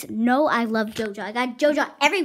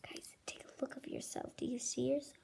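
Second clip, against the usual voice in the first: whisper; 2 semitones higher, 14 dB quieter, faster than usual. Acted. Whispering to viewers about reflection.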